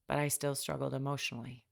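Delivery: ordinary delivery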